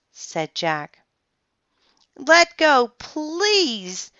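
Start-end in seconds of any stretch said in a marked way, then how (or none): 2.2-2.9 high, loud plea; pleading shout. A narrator's reading. Girl begs the octopus to release the sub, high shout. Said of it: "Annie shouted at the octopus". 3.0-4.1 please, said high but at normal level; a small high begging please; pleading. A narrator's reading. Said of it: "Annie shouted at the octopus".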